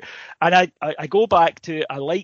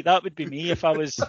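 ordinary delivery

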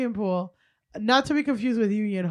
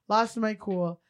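ordinary talk

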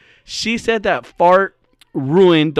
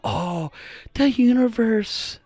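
slow, higher spacey impression; Kiera Mia; 4 semitones higher, 6 dB quieter, slower than usual. Mocking a friend tuned into the universe, lightly airy impression. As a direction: lightly imitate someone tuned into the cosmos. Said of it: "This is what she talks, by the way".

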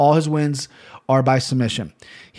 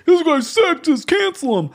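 mock outraged critic; 13 semitones higher than usual. Mocking imagined critics of his women's fights remark in a high put-on voice. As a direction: pretend to be a shocked online critic.

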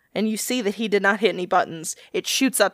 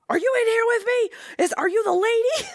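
timid ghost hunter voice; 14 semitones higher than usual. Retold or imitated. Retelling a ghost hunter's question.

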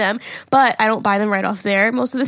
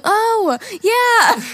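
much higher, louder hammed-up "Oh, yeah"; mock dude voice; 11 semitones higher, 4 dB louder than usual. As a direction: belt a silly "Oh, yeah". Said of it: "you're not, like, going".

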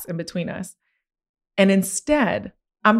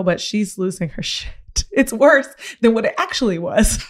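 laughing through the confession, a bit louder; 4 semitones higher, 3 dB louder than usual. Real, not acted. Telling the therapist about her meltdown, laughing. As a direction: let the laugh take over.

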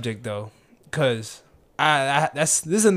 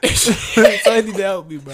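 laughing plea; 4 semitones higher, 4 dB louder than usual. Real, not acted.

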